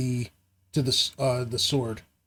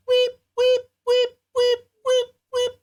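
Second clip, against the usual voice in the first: very high, far mechanical weep; machine weeping sound; 5 dB louder than usual. A narrator's reading. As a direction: imitate a piercing alarm. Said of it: "You hear".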